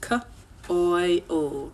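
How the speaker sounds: K-oi-ul slowly drawn out, each sound held; long stretched K-oi-ul. Shown as a demonstration. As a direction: pull coil apart slowly. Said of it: "Let's stretch it".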